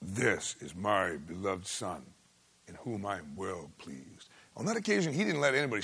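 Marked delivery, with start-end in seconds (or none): 0.0-4.3 low, slow, hushed divine pronouncement; a hint of God speaking from heaven; lightly voiced heavenly proclamation. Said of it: "that he shouted".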